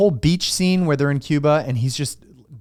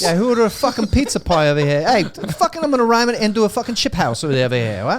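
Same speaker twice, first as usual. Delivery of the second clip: much higher mock Cockney accent; hammed-up Cockney; 7 semitones higher than usual. Friend keeps the Cockney Bond riff going, high mock Cockney. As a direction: rant like a London cabbie. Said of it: "Cockney James Bond".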